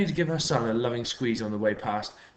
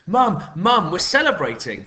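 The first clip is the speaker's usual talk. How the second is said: high, loud, bursting with news; a boy calling out in glee; excited cry; 8 semitones higher, 9 dB louder than usual. A narrator's reading. Boy rushing home calls to his mum, high and loud. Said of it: "he cried".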